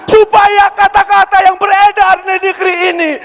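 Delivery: high, loud preacher's call to the audience; fervent preaching. Acted. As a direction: rouse the crowd to read the Quran.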